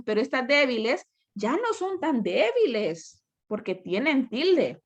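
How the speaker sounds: ordinary talk, nothing marked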